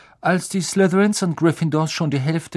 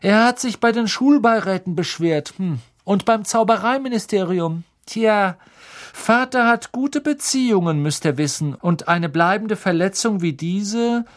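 high, louder, self-satisfied voice; smug boasting schoolboy; 8 semitones higher, 4 dB louder than usual. A narrator's reading. Malfoy brags about his father's connections, high and smug.

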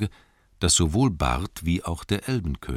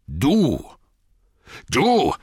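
loud, rising cry of outrage; furious shout; 9 semitones higher, 6 dB louder than usual. A narrator's reading. Thorin turns on Bilbo, shouting in rage. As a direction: burst out, speechless with fury. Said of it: "rief Thorin".

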